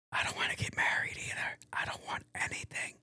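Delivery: unvoiced whispered aside; hushed confession about marriage. Real, not acted. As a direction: drop to a secret whisper.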